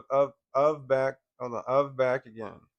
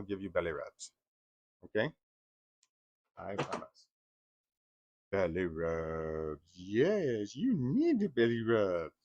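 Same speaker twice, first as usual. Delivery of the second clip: hushed cooing voice drawn out; soothing pet talk; 5 semitones lower, slower than usual. Real, not acted. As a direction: croon it low and gentle to the dog. Soothing the dog.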